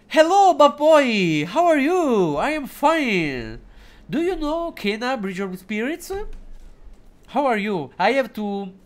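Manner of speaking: high, slow little put-on voice; hammed-up little voice, high and slow; small voice. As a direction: squeak it out like a tiny character. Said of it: "a small voice now for five minutes".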